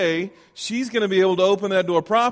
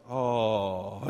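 low pitying crowd groan; mock crowd groan; 8 semitones lower, 6 dB quieter than usual. Comedian mimics the graduation crowd with a low pitying groan. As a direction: groan like a whole audience feeling sorry. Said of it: "the whole crowd went".

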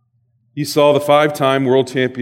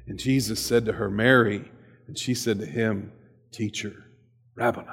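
tender hush as Mary recognizes Jesus; hushed reverent reading; 6 semitones lower, 9 dB quieter than usual. Real, not acted. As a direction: let the name land softly, almost breathless. Hushed climax of scripture reading.

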